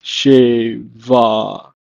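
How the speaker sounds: "sheva" slowly, in two separated syllables; teacher modelling "sheva" syllable by syllable; Hebrew pronunciation demo. Shown as a demonstration. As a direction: draw out "sheva", pitch easing down. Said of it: "feminine form is pronounced as".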